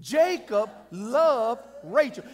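Jacob, loved, Rachel each drawn out with weight; deliberate preacher's emphasis on "Jacob loved Rachel"; slow emphatic delivery. Shown as a demonstration. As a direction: stretch "loved" and set every word apart. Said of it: "one more time for emphasis".

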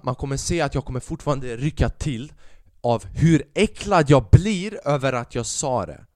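normal talking voice

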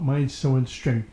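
ordinary delivery

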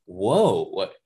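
drawn-up questioning disbelief, lightly acted; mock surprise. Host retells seeing the mature TV rating, mock surprised. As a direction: replay a baffled double take.

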